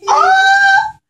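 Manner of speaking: sudden high yelp of surprise; high startled yelp. Acted. The king yelps when the bee lands on his nose, high. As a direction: yelp as if stung.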